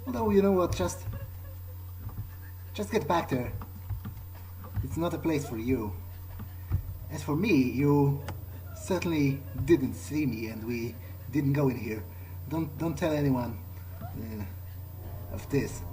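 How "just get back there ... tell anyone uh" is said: normal talking voice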